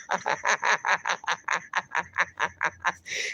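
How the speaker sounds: soft extended laugh, fading out; real long giggle. Real, not acted. Host laughing at tariffs on watch hours, long giggle. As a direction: giggle on until it dies away.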